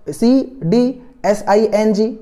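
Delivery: slow, separated letters; spelling it out. Shown as a demonstration. Spelling out the trick word.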